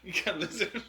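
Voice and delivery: laughing. Real, not acted. Laughing at lizard joke.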